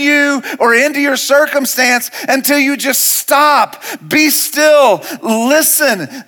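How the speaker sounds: high, driving preacher's exhortation; full-force preaching. Acted. Pastor telling the church to stop complaining, forceful preaching. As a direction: hammer each command home to the congregation.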